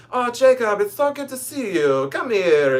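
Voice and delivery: put-on high, effusive sweet old professor; professor's voice. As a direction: gush a greeting in an imitated older man's high voice. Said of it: "I use this voice, this is literally how he sounds".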